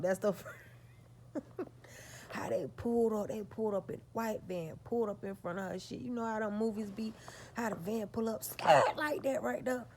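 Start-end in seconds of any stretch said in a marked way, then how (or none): 0.6-2.2 short airy laugh; breathy laugh. Real, not acted. Laugh mid-story. 8.4-9.9 scared. Real, not acted. Describing scary van.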